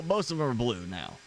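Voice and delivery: ordinary delivery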